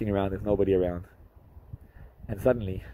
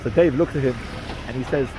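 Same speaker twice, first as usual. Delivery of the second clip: ordinary delivery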